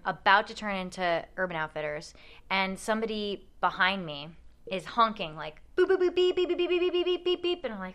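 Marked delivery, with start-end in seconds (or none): 5.8-7.6 high beeping horn noises; rapid mock horn beeps; imitated car horn. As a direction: beep it like an angry car horn.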